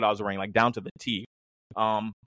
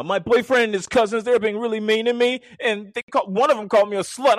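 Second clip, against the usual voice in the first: higher, upset girl's complaint, lightly voiced; upset sister; 11 semitones higher, 4 dB louder than usual. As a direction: play the upset little sister reporting it. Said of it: "She's like".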